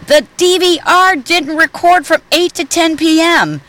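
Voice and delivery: put-on high-pitched boy complaining; kid breaking in. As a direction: blurt it like an upset boy. Son Peter breaks in.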